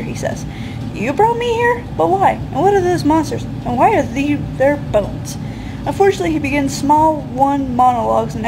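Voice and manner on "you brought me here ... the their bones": higher, bewildered hero voice; confused hero. Acted. Lost player firing confused questions at the darkness. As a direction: ask it all in a rising, baffled voice.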